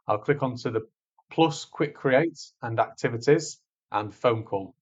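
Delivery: ordinary talk, nothing marked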